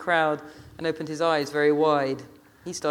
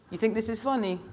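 reproachful Rasta woman; 6 semitones higher, 4 dB quieter than usual. A narrator's reading.

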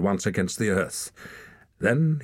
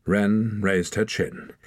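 plain reading voice

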